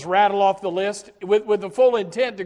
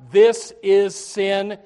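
slow emphasis; 6 dB louder, much slower than usual. Acted. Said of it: "slow emphatic confession".

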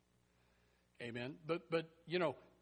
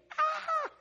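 short, high, loud "ah"; mock startled yelp; imitated startled shriek; 10 dB louder than usual. Girl startled by Peter at the gate, mock shriek.

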